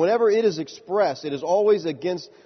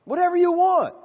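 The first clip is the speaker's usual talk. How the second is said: "Whatever you want" repeated much higher and louder; emphatic repeat; 13 semitones higher, 4 dB louder than usual. Shown as a demonstration. Preacher repeating to congregation, much higher, emphatic. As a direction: lift the repeat up high, hammering it home.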